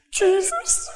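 high breathless gasp of Jesus; swooning ladies. Retold or imitated. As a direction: swoon over the name like the women. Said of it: "They said".

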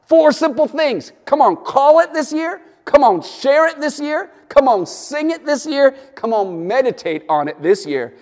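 high, loud, rising rallying calls; full-force preaching. Acted. Pastor rallying his congregation, preaching at full force. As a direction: fire the church up line by line.

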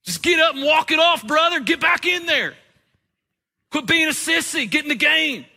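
hammed-up macho coach telling him to walk it off; tough-love trainer. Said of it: "what the trainer didn't say".

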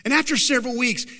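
normal talking voice

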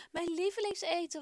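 put-on boy exclaiming happily over his favourite food; happy cry. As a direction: cheer as you smell your favourite dinner. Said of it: "roept hij blij".

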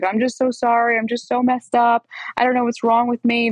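lightly hammed-up poor-me voice; mock woe-is-me. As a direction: mimic a pitiful apology without real tears. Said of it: "The whole sad, poor, woe is me thing".

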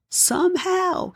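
slow high somehow. Shown as a demonstration. A preacher repeats somehow, high and slow. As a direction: stretch somehow up high, slowly.

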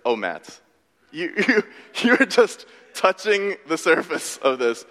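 chuckling, slow gentle correction; indulgent chuckle. Retold or imitated. God gently correcting Matt about the passage, chuckling. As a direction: imitate an amused, knowing God. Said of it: "God kinda chuckled".

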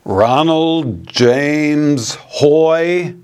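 slow, drawn-out stern mother calling each name; mock stern mom summoning her son; mom calling full name. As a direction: spell out each name like a mother who means trouble. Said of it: "whenever she used our full name".

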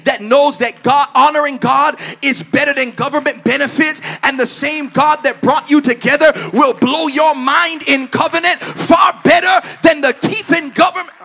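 loud, building sermon voice; impassioned preaching. Real, not acted. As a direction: preach it with mounting force to a shout. Preaches at full force.

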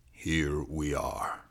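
low, soft, slow "Here we are"; subdued echo. Acted. Cop lets the account sink in, subdued. Same words echoed, subdued.

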